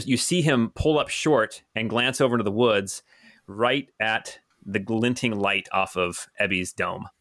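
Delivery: ordinary delivery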